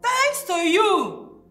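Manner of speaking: slow, high, loud accusation; angry blame. Acted. Mother blaming the therapist, loud and drawn out. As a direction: hurl the blame out loudly.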